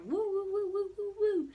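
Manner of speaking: high woo woo sound; swinging light noise. Retold or imitated. Teller imitates the swinging bar light, high woo sounds.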